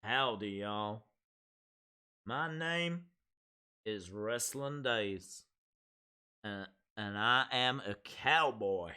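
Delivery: slow, low Texan drawl; amateur Texan cowboy impression, drawn out; Texan accent. Shown as a demonstration. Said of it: "go straight to, like, Texas".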